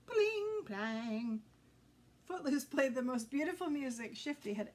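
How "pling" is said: high bright pling like a plucked string; sung pling. A narrator's reading. Imitating ukulele note.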